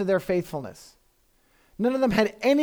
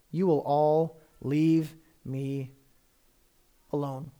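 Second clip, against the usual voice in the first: sorrowful; 4 semitones lower, slower than usual. Acted. Jesus predicting abandonment.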